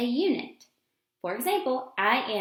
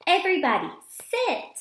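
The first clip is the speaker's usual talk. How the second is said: louder call, high sharp sit; calling the dogs; 7 semitones higher, 4 dB louder than usual. Acted. Girl ordering all the dogs to sit, louder with a high sit. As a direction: command the whole pack.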